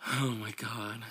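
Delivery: ordinary delivery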